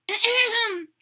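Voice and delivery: high loud put-on ahem; throat clear. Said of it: "She cleared her throat".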